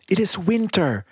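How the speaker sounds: high-pitched storybook bird, gently protesting; pleading little bird. A narrator's reading. Bird answering the prince's plea to stay, gently protesting.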